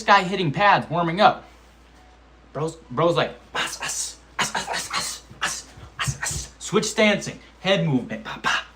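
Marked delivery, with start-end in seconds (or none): 3.5-6.5 breathy unvoiced us us with each strike; punch puff sounds. Mimicking the opponent warming up, breathy punch puffs. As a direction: puff out air like a fighter on pads. Imitating opponent's pad work.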